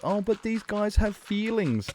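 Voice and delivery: mocking whine. Mimicking Legal Aid defending offenders, a simpering mock whine. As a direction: sneer at them by sounding syrupy.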